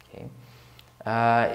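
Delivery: normal talking voice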